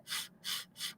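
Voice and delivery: "f, f" breathed out, unvoiced; f sound. Shown as a demonstration. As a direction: push air through teeth for "f". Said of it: "hace el sonido de la F". (sonido "f" soplado)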